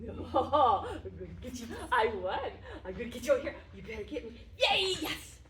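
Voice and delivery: giggly teasing chase calls; laughing tag-game voice; playful laughing. Real, not acted. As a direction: laugh through the game with the dog. Chasing game with dog.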